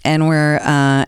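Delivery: ordinary talk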